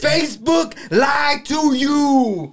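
slow, high, ranting [name]; a hint of a preaching [name]. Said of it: "I feel like [name] right now".

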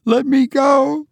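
high-pitched plea to be released; crying out. A narrator's reading. Wife held back by husband, crying out. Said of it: "she cried".

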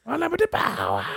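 very high, strained villain cry; mock Palpatine crowing in a put-on voice; Emperor impression. Emperor turning on Mace Windu, hammed-up high cry. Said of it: "He's like".

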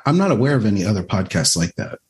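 ordinary talk

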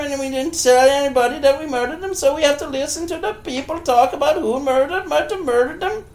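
high put-on producer voice; high mock voice. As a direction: squeak it like a silly producer. Playing show producers.

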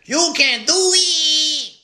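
high, slow, crying shout; girlfriend's cheer. Retold or imitated. Girlfriend cheering him on, a slow high crying shout. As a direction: wail it out like an overcome cheerleader. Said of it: "My girlfriend used to say".

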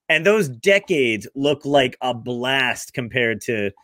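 ordinary talk, nothing marked

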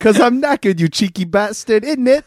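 high, cheeky British banter; British accent. Acted. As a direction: ham up the British slang accent. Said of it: "using my most famous British accent".

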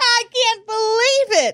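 can't believe it, laughing; laughing disbelief. Real, not acted. Laughing at dentures.

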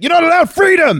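very high, loud mock shout; comic shout. Mocking Chris Pratt shouting at the girl, very high and loud. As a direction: blurt it out absurdly. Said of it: "he just shouts it".

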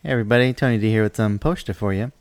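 usual speaking voice

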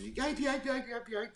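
high, soft imitated puppy yips; dog yelping. Storyteller imitating the hit dog running off, high yelps. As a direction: mimic a hurt dog yelping away. Dog runs off after collision.

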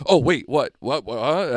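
a flustered Southerner going what, what; southern accent. Retold or imitated. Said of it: "dial up that southern accent".

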